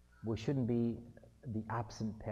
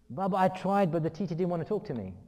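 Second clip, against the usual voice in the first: put-on louder, higher-pitched child's excuse; acted protesting son; 5 semitones higher, 7 dB louder than usual. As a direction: plead your case to dad, indignantly.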